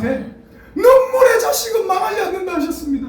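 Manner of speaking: mother crying out a tearful prayer for her son; mother's tearful wailing prayer. Retold or imitated. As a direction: wail it like a weeping mother at dawn prayer. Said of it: "나 들으라고 하는 것 같아".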